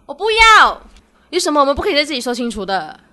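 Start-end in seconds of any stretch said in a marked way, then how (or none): 0.0-0.8 high, loud flat refusal; louder and higher, a firm no; refusing. Acted. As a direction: girlfriend snapping back at boyfriend, loud and high. Refusing boyfriend's request.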